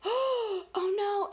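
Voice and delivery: high, drawn-in mock dismay; alarmed food character. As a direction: act out a startled little food.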